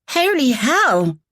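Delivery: high, slow startled yelp. A narrator's reading. Woman yelps on seeing Jack, high yelp. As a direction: let it burst out in shock. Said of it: "Thais yelped".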